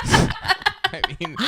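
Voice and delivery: high, loud laughing talk. Real, not acted. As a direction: talk through a big laugh. Laughing through words.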